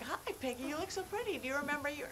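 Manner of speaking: high, soft cooing greeting; sweet-talking a doll. Retold or imitated. Guest cooing at the haunted doll, sweet-talking. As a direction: coo to it in a small high voice.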